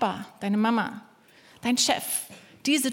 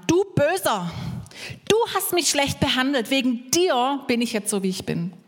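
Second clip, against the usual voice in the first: accusing victim; 3 semitones higher than usual. Acted. Preacher as victim accusing the villain, reproachful. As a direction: blame the villain as the wronged victim. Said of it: "Dann gibt's ein Opfer".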